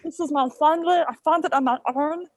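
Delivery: a hint of gruff little alien voice; lightly voiced Stitch, earnest; understated Stitch voice; Stitch impression. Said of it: "that's a pretty good Stitch impression".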